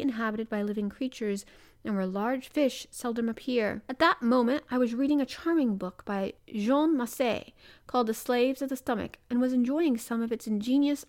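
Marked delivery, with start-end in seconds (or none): none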